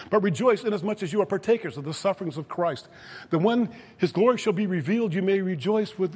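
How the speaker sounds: forceful preaching. Acted. Preacher quotes Peter on rejoicing in suffering, forceful preaching. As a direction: lift the verse up for the congregation.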